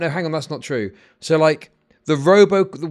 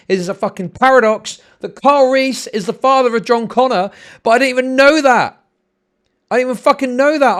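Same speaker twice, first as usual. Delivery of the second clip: loud, higher, worked-up complaint; exasperated rant; 6 semitones higher, 8 dB louder than usual. Real, not acted. Streamer venting about grandmother's movie edits, loud exasperated rant. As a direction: let the outrage build and stay loud.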